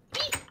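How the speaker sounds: tiny high beep; teeny half squeak. Retold or imitated. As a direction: imitate a toy barely squeaking. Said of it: "there's a teeny tiny half squeak".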